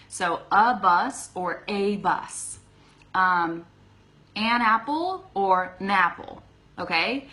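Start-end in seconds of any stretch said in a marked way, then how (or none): 0.1-2.6 "a" first as "uh", then as "ay", slowly; contrast of a. Shown as a demonstration. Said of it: "That's how I say it". 4.4-6.4 "an apple" then run together as "napple", slowly; slow demo linking the n onto "apple". Shown as a demonstration. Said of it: "when I say napple".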